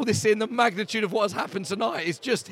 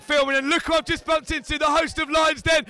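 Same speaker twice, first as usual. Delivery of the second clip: shouting over the crowd; 6 semitones higher than usual. Real, not acted. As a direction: shout to be heard above the fan zone noise. Shouting over music at the fan zone.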